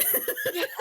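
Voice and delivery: breaking into real laughter at the end; laughing. Real, not acted. Host corrects players who answered with total confidence, laughing. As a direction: try to correct them, then crack up. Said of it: "I'm laughing because you guys".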